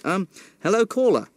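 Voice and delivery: eager greeting. Acted.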